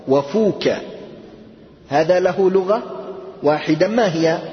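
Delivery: ordinary talk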